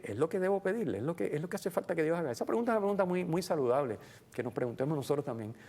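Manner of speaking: ordinary talk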